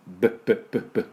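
ブッ as short repeated popping b bursts; B sound. Shown as a demonstration. Teacher showing learners the B of bag, ブッ in short bursts. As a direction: pop out ブッ like baby babble, again and again. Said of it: "赤ちゃんのバブバブと".

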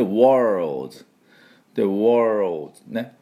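worlds drawn out slowly. Shown as a demonstration. Teacher modelling worlds for the class, slow. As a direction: stretch worlds. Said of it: "この音なんですね".

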